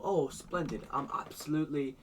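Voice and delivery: mock delight at the breakfast table; a touch of stagy enthusiasm; Losses delighted. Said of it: "And he'd go".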